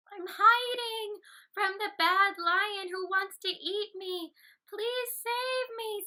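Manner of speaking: high, slow, pleading little rabbit; frightened rabbit pleading with the elephant for help; scared little rabbit. Acted. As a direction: beg for help in a tiny high voice. Scared rabbit voiced in story.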